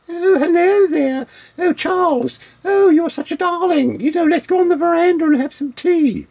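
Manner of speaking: very high-pitched posh upper-class British lady. Acted. Imitating the girl from the tapes, mock upper-class British. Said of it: "that's how they talk over there".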